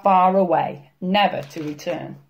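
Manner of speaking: usual speaking voice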